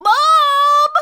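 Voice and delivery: high, loud cry for mom; cried out. Acted. Splat crying out to his mom, high and loud. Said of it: "cried Splat".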